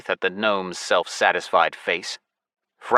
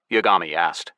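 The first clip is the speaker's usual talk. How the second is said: plain reading voice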